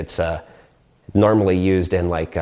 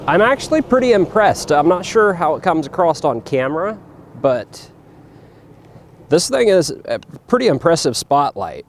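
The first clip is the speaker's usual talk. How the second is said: raised voice over a running fan; shouting over machinery; 5 semitones higher than usual. Real, not acted. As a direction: speak up above the engine noise. The fan's running on the Jeep.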